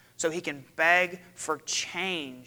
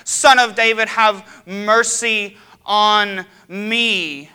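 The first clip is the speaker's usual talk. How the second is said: very loud, high, slow desperate shout; crying out louder; 5 semitones higher, 13 dB louder, much slower than usual. Retold or imitated. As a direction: shout it over the whole crowd, stretching every word. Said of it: "He cries out louder".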